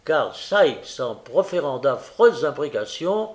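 usual speaking voice

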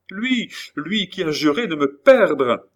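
high, loud frightened cry; panicked outburst. A narrator's reading. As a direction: blurt it out in terror. Terrified woman names her pursuer.

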